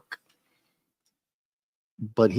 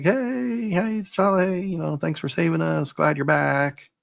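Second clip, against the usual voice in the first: cheering crowd; 7 semitones higher, slower than usual. Retold or imitated. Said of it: "people are like".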